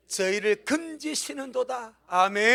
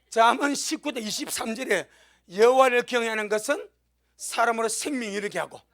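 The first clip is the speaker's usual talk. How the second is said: ordinary talk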